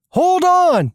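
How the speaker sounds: sudden high-pitched cry of warning; urgent warning. Acted. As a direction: yell it to passengers before swerving. Driver warns before a sharp turn.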